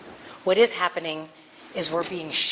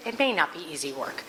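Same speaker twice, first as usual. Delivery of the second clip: ordinary talk, nothing marked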